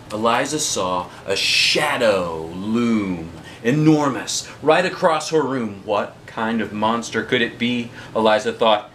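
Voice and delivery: plain reading voice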